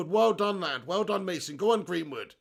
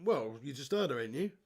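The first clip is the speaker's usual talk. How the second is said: normal talking voice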